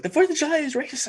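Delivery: high mocking girl voice. Mocking a girl's claim.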